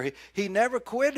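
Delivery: loud, high, punching quit; loud, emphatic proclamation; emphatic preaching. Acted. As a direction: proclaim it to the whole church. Emphatic then quiet repeat.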